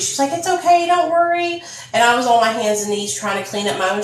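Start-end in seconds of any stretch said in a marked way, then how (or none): 0.3-1.6 higher, gentle reassuring tone; sweet comfort. Retold or imitated. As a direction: soothe a friend who is embarrassed. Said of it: "she was so sweet".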